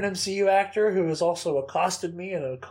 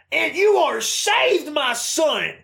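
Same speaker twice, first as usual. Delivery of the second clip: much higher, louder, slow preacher-like blessing; mock preacher blessing; 11 semitones higher, 8 dB louder, much slower than usual. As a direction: bless the fan like a showy preacher. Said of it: "reaches over to me and goes".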